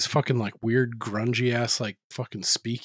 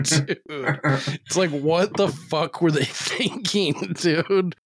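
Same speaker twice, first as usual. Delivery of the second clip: laughing disbelief; 5 semitones higher, much slower than usual. Real, not acted. Incredulous at the ridiculous title.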